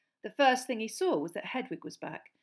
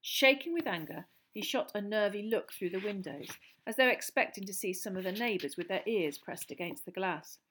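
plain reading voice

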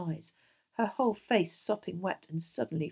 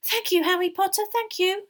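high, loud, grateful elf squeak; breathless little house-elf; 13 semitones higher, 8 dB louder than usual. A narrator's reading. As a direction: gasp thanks in a squeaky elf voice. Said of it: "said Dobby breathlessly".

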